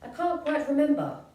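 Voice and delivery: lightly voiced Gruffalo. Gruffalo answers his child, lightly voiced. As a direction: Gruffalo puzzling over his memory, mildly voiced. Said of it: "the Gruffalo said".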